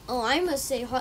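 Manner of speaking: normal talking voice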